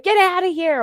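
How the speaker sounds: high, sharp retold shout at a cat; shooing yell. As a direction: chase the cat off with your voice. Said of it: "I'm like".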